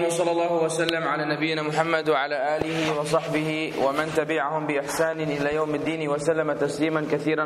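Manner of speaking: rapid formal praise recited in Arabic; brisk devotional preamble; formal Arabic opening invocation. Acted.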